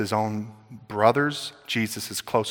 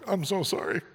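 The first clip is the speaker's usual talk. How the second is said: put-on guilty kid; 6 semitones higher, 6 dB quieter than usual. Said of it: "I'm like".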